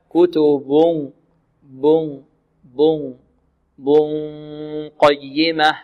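slow "كتب قيمة" with a long nasal hum gliding from b to qaf; drawn-out nasal ikhfa in "كتب قيمة"; hidden noon. Shown as a demonstration. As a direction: hide the n of "بن" in a soft held hum toward the q. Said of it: "مخرج الباء إلى مخرج القاف، هكذا".

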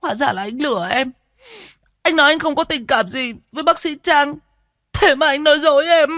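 very high, wailing accusation; Siêu, boiling with rage. A narrator's reading. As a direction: build to a loud, shrieked accusation. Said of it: "làm cho máu của cô Siêu sôi". (giận sôi máu, gào lên)

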